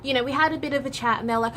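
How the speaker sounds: ordinary talk, nothing marked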